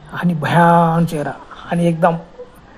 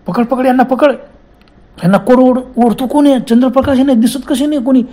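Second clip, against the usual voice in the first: higher, urgent calling out; frightened shouting; 6 semitones higher than usual. Acted. Friends shouting in fear as something drags them away. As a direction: yell for your friend to grab them. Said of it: "आणि ते मोठमोठे ओरडायला लागले".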